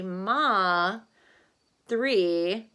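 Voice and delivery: ordinary talk, nothing marked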